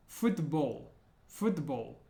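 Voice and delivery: \"football\" dengan L gelap di belakang tenggorokan; Russian hard L. Shown as a demonstration. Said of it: "the L sound is like ul in the back".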